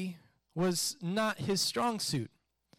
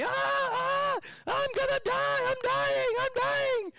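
very high, panicked wailing yell; hammed-up child screaming in mortal panic; imitated kid yelling; slower than usual. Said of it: "he's just yelling and yelling and yelling".

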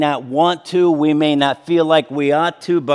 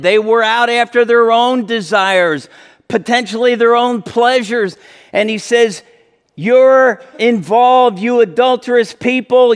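higher, louder impassioned sermon; full-force preaching; 7 semitones higher, 3 dB louder than usual. Acted. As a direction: preach it with rising fire.

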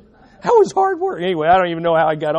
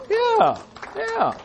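wry high yeah; 13 semitones higher, 4 dB quieter than usual. Real, not acted. Wry reaction to anniversary.

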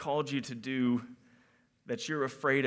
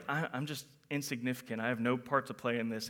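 soft, lower, quick dejected mumble; deflated self-pity; 4 semitones lower, 7 dB quieter, faster than usual. Pretend. A listener doubting their worth, softly dejected. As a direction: sound small and worthless. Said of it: "We feel like".

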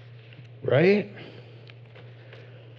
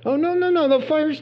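mock alarm; 12 semitones higher than usual. Mock friends backing away.

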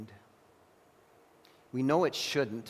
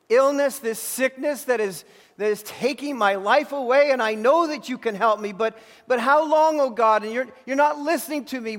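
raised, higher voice crying out to God; desperate pleading prayer; 9 semitones higher, 7 dB louder than usual. Acted. Preacher voicing a sufferer, crying out to God in anguish. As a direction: beg God loudly, voice climbing with each how long.

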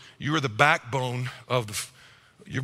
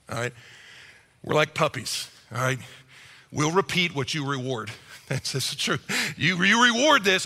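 normal talking voice

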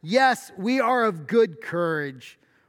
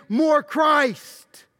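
"more Christ" shouted high and loud; stress on more Christ; 8 semitones higher, 5 dB louder than usual. Real, not acted. Preacher drives home his point to the church, shouting "more Christ". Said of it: "The emphasis is on verse three".

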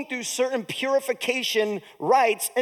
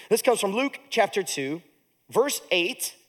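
ordinary delivery

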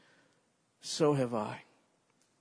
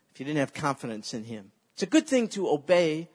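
ordinary talk, nothing marked

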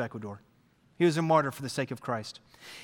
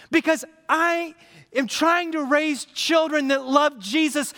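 preaching; 7 semitones higher, 5 dB louder than usual. Acted. Pastor preaching to the congregation, loud and high. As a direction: drive it home to the church.

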